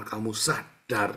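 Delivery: ordinary talk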